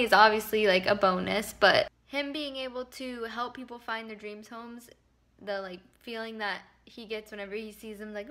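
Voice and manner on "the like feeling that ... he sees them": ordinary talk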